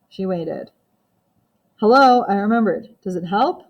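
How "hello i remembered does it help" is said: ordinary delivery, nothing marked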